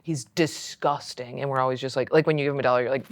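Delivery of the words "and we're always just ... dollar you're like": ordinary talk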